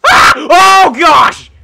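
loud high oh gosh; startled yelp. Real, not acted. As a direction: caught off guard. Creeper launches itself.

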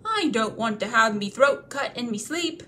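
loud, rough troll voice; wary troll. Acted. Bert ordering Bilbo's toes held to the fire, loud. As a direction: growl it out warily.

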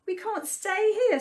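high little lamenting voice; mildly plaintive storybook mouse; mournful mouse. A narrator's reading. Mouse laments the burnt woodland.

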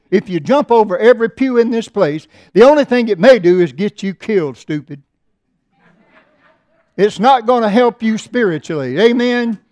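fiery sermon delivery; preaching at full force. Acted. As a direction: drive it home to the pews.

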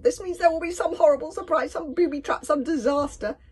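high, loud squeal of dread; panicky wail. A narrator's reading. Fishlegs predicting a booby trap, panicky. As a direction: fret at a high pitch.

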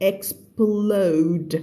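"explode" drawn out, each sound of k-s-p-l separated; clear consonant cluster. Shown as a demonstration. Teacher showing students the cluster, "explode" slowly broken apart. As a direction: stretch "explode" out to show the p and l. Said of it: "ต้องออกให้ชัดนะคะ".

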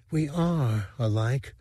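a hint of a fragile alien rebel, gently reproachful; dying lamproid lover. A narrator's reading. Dice speaking to Sivrak.

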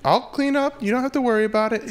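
high, placating politeness; smooth him over sweetly; overly polite. Retold or imitated. Comic placating the angry neighbor, high and polite. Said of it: "I was like".

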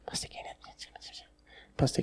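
put-on breathy whisper of a shy young lady; imitating a timid girl whispering. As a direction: whisper it so he can barely hear. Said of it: "I can't hear you, talk louder".

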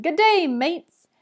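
slow, loud hearty hello; shouted greeting. A narrator's reading. Lightning Lou greeting the school assembly, loud slow shout. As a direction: boom it out to the room. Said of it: "Lightning Lou shouted".

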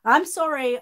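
a loud, high, exasperated "I'm sorry"; frustrated outburst. Real, not acted. Answering a viewer's complaint, suddenly loud and exasperated. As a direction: burst out in real frustration. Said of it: "I'm getting a bit frustrated here".

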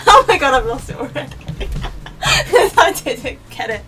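talking through real laughter; cracking up mid-sentence; laughing. Real, not acted. Laughing at own karaoke.

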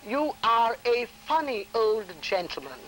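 slow, carefully precise diction; very proper diction. Retold or imitated. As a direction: speak each word properly for the examiners. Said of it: "very proper with my diction".

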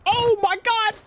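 shocked gasp. Real, not acted. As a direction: blurt it out in sudden shock. Sudden shock during a firefight.

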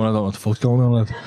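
dropped right down in pitch; deep, subdued confusion; low bewildered aside. Retold or imitated. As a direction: say it low, out of your depth. Smokey's bewildered aside, acted.

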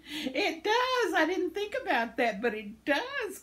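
high-pitched excited realization; high, eager exclamation of agreement; delighted agreement. Real, not acted. Delighted agreement with a viewer's comment.

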